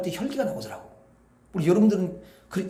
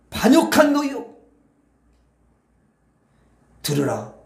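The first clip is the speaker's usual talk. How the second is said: angry rebuke; 6 semitones higher, 5 dB louder than usual. Acted. Moses rebukes the rebellious people.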